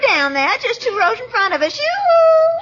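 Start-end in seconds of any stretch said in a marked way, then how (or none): 1.8-2.6 high, loud yoo-hoo; calling out. Acted. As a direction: hail someone a few rows away. Hailing Marshall two rows ahead.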